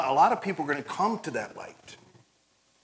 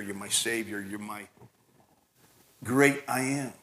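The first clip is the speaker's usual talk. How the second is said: tender devotion; 5 semitones lower, 6 dB quieter than usual. Pretend. Speaker answers Jesus tenderly.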